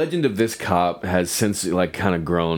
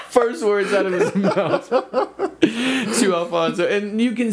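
laughing; slower than usual. Real, not acted. Laughing while retelling.

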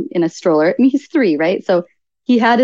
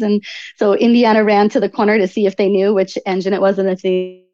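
normal talking voice

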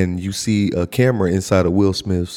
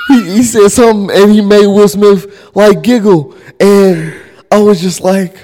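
laughing; 11 semitones higher, 7 dB louder than usual. Real, not acted. Laughing while retelling celebrity moment.

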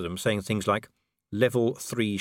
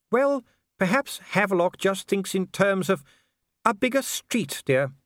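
lady's voice; 11 semitones higher, 4 dB louder than usual. A narrator's reading. Sybil voiced in the story.

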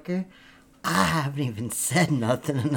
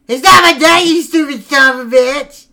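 much higher, loud furious shout; screaming it despite the promise; ironic yell; 10 semitones higher, 12 dB louder than usual. Real, not acted. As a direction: promise calm, then explode. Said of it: "in a very calm voice".

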